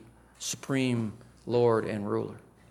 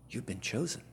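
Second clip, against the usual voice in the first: much softer, intimate and quiet; soft assurance; 9 dB quieter than usual. Acted. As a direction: tell each one gently. Softly assuring hearers.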